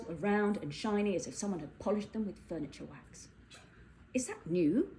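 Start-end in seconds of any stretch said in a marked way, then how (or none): none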